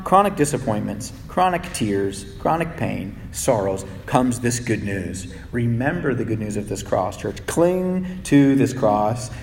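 quiet, slow comfort over pain; soft tender preaching. Acted. Pastor comforting his grieving church, soft and slow.

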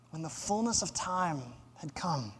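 quiet, weighty scripture echo; hushed repetition. Real, not acted. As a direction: drop right down and let it sink in. Verse repeated softly for weight.

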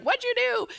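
very high-pitched, alarmed wife; imitated yelling. Wife demanding answers from husband on the phone, mock yell. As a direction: shriek the question in mock alarm. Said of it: "Like, yelling".

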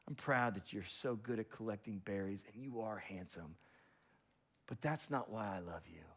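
soft, low loving father; gentle dad at bedtime, slowing down; tender bedtime father. Acted. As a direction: speak softly to a sleepy child. Dad putting son to bed.

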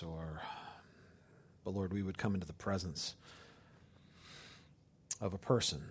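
soft, low, hushed prayer voice. Acted. Pastor praying over congregation, hushed and low. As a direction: pray gently and quietly, letting it settle.

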